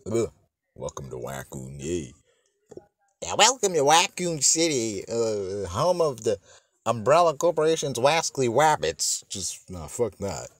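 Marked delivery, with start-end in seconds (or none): 0.7-2.1 low, soft, deadpan greeting; mock-bored, low and quiet; unenthused welcome. Deadpan take before redoing it lively. 3.2-9.2 high, playful cartoon announcer; hammed-up cartoon greeting; Elmer Fudd. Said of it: "if Elmer Fudd was in".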